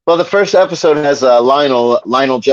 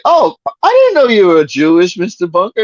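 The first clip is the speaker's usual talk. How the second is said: Lionel quote; 5 semitones higher, 4 dB louder than usual. Retold or imitated. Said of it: "so he's like".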